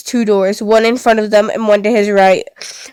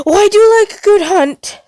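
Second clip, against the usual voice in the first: high, delighted menace; gleeful villain; 11 semitones higher than usual. Acted. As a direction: relish the hunt with glee. Villain relishing the hunt.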